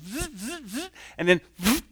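buzzing hair clippers with the voice, zzz; clipper buzz imitation. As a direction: buzz like electric clippers mid-haircut.

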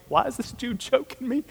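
confused man being choked; bewildered victim. Retold or imitated. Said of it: "He's like".

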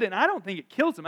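ordinary delivery

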